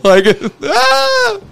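very high running shriek; joking about sprinting scared; mock yell. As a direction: shriek while fleeing. Acting out sprinting in fright.

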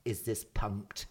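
slow, quiet, puzzled ask; low confused aside to the manager; retold muttered aside. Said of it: "I heard him say to his manager".

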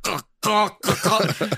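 mock crow cawing; imitating a cawing crow. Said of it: "watch this dude just go".

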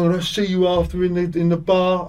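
high, loud mock threat; hammed-up pub-brawl warning; threatening heckler. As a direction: play the fan promising a bar fight. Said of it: "So he's going".

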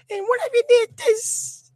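slow, very high falsetto; mocking movie woman. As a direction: drag it out in a mock woman's squeal. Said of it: "she's like".

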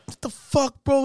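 very high imitated outrage; hammed-up shock, pitch leaping up; outraged disbelief. Brother hears tapes went to kid, imitated squawking outrage.

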